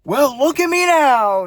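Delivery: high, slow, gloating; mock smug boast. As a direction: taunt like you proved them wrong. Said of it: "I can't say".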